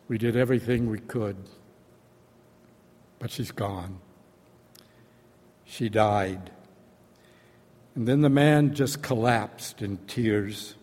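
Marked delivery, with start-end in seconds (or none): 0.1-6.5 retold solemn news, much softer. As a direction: break the news gently and gravely. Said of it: "said solemnly".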